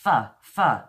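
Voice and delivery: "F, f" as two punched "fuh" sounds; Cockney f sound. Shown as a demonstration. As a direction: sound out "F, f" loudly, teeth on lip. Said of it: "if you want to learn the Cockney English".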